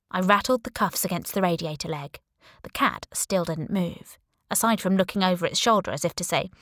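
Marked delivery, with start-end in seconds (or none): none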